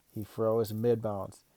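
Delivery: plain reading voice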